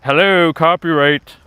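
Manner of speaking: mock robotic YouTube voice, slow and drawn out; YouTube copyright bot. Vlogger mocking YouTube's copyright bot, mock robotic voice. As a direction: play the copyright bot saying hello. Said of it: "YouTube will be like".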